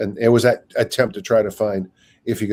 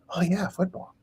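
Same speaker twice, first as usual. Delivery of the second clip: higher, lightly voiced daydreaming; dreamy mock excitement; 8 semitones higher, 6 dB quieter than usual. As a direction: imitate your mind drifting off to football. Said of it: "my brain would go".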